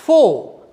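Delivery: फो with F and silent R; फो as a model to repeat; correct pronunciation. Shown as a demonstration. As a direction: say फो firmly, dropping the final R. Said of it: "क्या बोलना है?".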